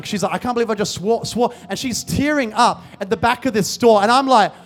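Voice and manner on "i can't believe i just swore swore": ordinary talk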